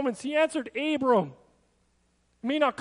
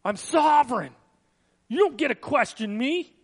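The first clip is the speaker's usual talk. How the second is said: scolding God; 4 dB louder than usual. Retold or imitated. God scolding Abram for questioning him, a mock stern rebuke. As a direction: play an offended sovereign rebuking his servant. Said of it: "He didn't scold him and say".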